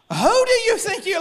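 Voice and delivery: high-pitched mock disbelief; hammed-up outraged onlooker; incredulous judging. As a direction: blurt it high, as if appalled by an outfit. Acted reaction to beachgoers' dress.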